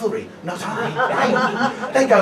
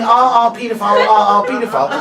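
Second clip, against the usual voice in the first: louder laughing mock scream; 2 semitones higher, 8 dB louder than usual. Comic retelling the woman running and screaming, laughing mock scream. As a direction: ham up the running shriek.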